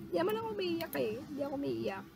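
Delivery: small soft pretend-weepy voice, rushed; mock tearful denial. Streamer jokingly denies crying over a kind customer, mock tearful. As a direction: softly play at holding back tears. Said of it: "Halos maiyak ako".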